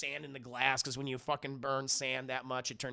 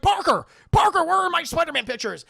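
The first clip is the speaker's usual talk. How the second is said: loud, high bellowing boss; hammed-up angry editor yelling for his employee; J J Jameson; 13 semitones higher, 12 dB louder than usual. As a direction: holler it like an irate newspaper chief. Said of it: "J K Simmons is there, J J Jameson".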